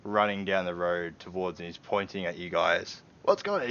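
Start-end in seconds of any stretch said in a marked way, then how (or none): 3.2-3.7 alarmed call. Acted. Homeowner runs up shouting.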